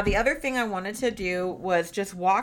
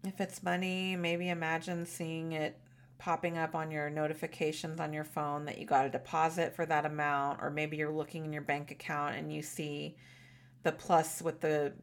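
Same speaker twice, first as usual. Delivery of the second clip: gentle, calm visualization guiding; guided meditation. Shown as a demonstration. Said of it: "a guided meditation and visualization".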